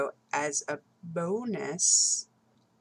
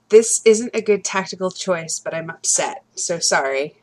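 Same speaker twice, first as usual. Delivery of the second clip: louder, upset outburst; upset in character; 10 dB louder than usual. Acted. Player voices upset character before attacking, loud and upset. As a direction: announce it loudly, upset but apologizing. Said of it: "and I say".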